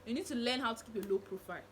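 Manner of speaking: ordinary delivery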